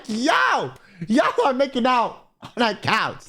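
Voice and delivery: high, drawn-out yo of disbelief; scandalised, stretched-out protest; incredulous call-out. Acted. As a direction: call out the couple, pitch way up. Acted calling out of couple.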